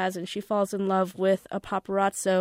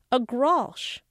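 put-on English accent; 4 semitones higher, 4 dB louder than usual. A narrator's reading. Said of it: "it sounded like a different word".

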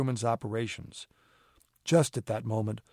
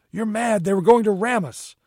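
high, loud and indignant; outraged protest; 8 semitones higher, 7 dB louder than usual. A narrator's reading. Accused mate shouting back at Holmes, outraged protest. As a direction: fling the accusation back heatedly.

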